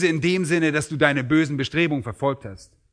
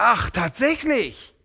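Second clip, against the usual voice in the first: much higher, mocking sarcastic master; sarcastic disbelief; 4 semitones higher than usual. Master mocking the servant's excuse, sarcastic. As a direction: sneer back his own words at him. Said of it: "Mit anderen Worten sagt er".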